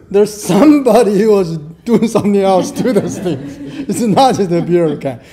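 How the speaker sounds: amused, talking through laughter; laughing. Retold or imitated. Lecturer joking about missing beer, laughing. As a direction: let laughter break into the punchline.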